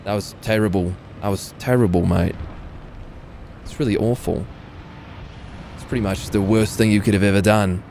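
mock-offended in a put-on Aussie-British accent; lightly hammed-up Brit-Australian accent; mixed Brit-Australian accent. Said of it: "new Brit-Australian accent".

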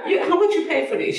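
high, fast, laughing disbelief; incredulous. Retold or imitated. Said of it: "I said".

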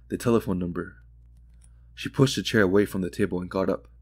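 usual speaking voice

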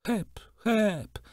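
high, thin calls to the horse; a little girl's high calls from the horse's back. A narrator's reading. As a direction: cry out in a tiny girl's voice. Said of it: "pokrzykiwała jeszcze".

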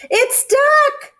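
very high, loud joyful shriek; excited shriek. A narrator's reading. Cat and squirrel finding duck home, shrieking. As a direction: shriek it out with the last word held high. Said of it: "they shrieked".

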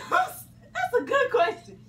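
high, slow, through laughter; laughing. Real, not acted. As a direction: laugh the words out. Laughing after call.

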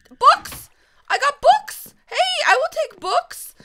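loud, high-pitched glee; excited shout, voice shooting up; delighted exclaiming. Real, not acted. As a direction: crow over a lucky find. Finds books in a chest.

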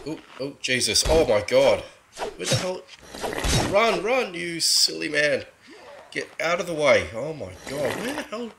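panicked high yelling while fleeing; frantic, scared shouts; being chased. Real, not acted. Fleeing executioner in game.

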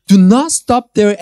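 ordinary talk, nothing marked

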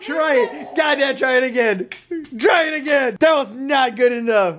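bossy big-sibling yell; shouting. Real, not acted. Yelling at sibling to retry.

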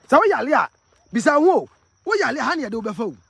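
loud, high call to viewers; excited loud call-out. Real, not acted. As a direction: raise the voice to call out. Walking vlogger calls out to viewers.

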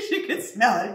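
laughing at the reek. Real, not acted. Host laughing at the smelly tincture. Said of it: "this is so smelly".

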